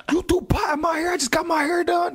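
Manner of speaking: very high outraged woman voice; hammed-up furious high voice; mock angry Tyree. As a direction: squeal it like she's livid about her hair. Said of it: "Tyree was like".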